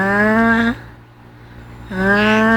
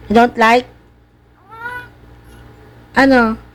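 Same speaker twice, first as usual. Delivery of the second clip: ordinary talk, nothing marked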